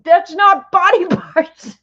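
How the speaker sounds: high, loud, slow mock indignation in German accent; imitated mad outburst. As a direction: act out an offended German coworker protesting. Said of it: "she was so mad at me".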